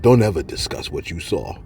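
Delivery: stern warning. Retold or imitated. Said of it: "she said".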